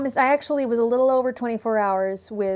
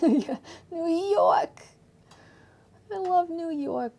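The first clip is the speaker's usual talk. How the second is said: slow, higher New York accent; hammed-up New York accent; 7 semitones higher, slower than usual. Shown as a demonstration. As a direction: drag it out like a New Yorker. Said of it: "I can do New York".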